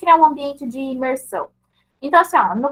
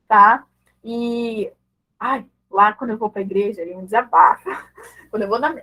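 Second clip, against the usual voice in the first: ordinary delivery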